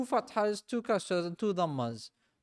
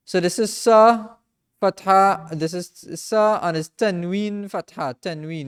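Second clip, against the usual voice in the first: loud teaching; 9 dB louder than usual. Shown as a demonstration. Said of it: "showing the letter sa".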